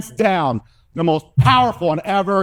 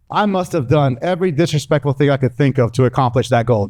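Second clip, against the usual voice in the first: usual speaking voice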